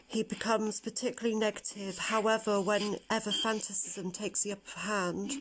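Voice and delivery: hushed, whispered card reading. Acted. Reads tarot card meaning.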